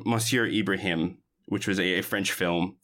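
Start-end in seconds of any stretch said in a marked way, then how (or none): none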